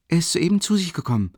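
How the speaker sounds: put-on a simple servant girl stating it plainly; Nastassja's voice.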